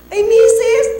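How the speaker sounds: loud, high "Ay, misis" as the doctor; doctor exclaiming loudly at the mother; startled exclamation. Retold or imitated. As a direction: burst out in surprise.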